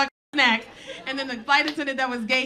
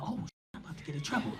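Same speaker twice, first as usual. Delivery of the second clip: whispered aside; 8 semitones lower, 10 dB quieter, much faster than usual. Retold or imitated. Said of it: "I was like".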